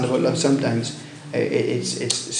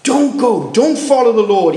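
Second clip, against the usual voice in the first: crowd urging; 8 semitones higher, 5 dB louder than usual. Retold or imitated. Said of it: "All of, uh, that".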